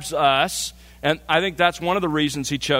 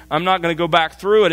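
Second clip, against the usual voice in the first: normal talking voice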